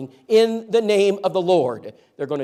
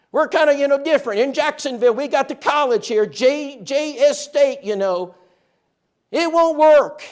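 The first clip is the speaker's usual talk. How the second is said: high, loud mocking voice; sceptical townsperson; 11 semitones higher, 10 dB louder than usual. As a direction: ham up the smug locals. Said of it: "That's what I was told".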